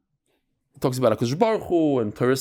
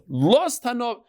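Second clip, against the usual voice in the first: "Lost hanob" lifted high and pressed; raised quote; 7 semitones higher, much slower than usual. Retold or imitated. Rabbi calling to his students, pitched higher. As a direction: call the phrase out high like the rabbi. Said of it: "That's how he".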